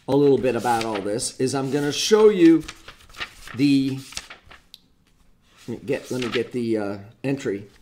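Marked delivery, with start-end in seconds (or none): none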